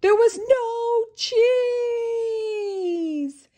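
high, slow wailing sob; crying shout. Acted. Krabs tells the crowd the bad news in a high, slow wail. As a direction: wail it out like he's breaking down. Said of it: "shouted Mister Krabs as he started to cry".

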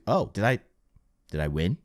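ordinary delivery

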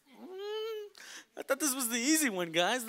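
high whiny complaint to the group; mock complaining. To sister on the hard trail, whining. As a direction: moan that the easy trail isn't easy.

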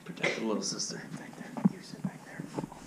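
hushed urgent whisper. Pretend. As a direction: whisper the plan. Hushed play-fight plan.

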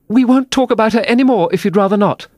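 high-pitched, hasty reassurance; placating Alice. A narrator's reading. Alice tries to soothe the offended mouse, high placating voice. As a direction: voice Alice appeasing someone, high and earnest.

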